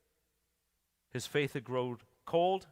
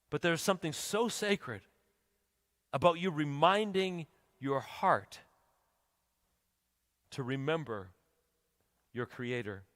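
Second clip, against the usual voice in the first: sacred hush. Acted. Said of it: "He's saying".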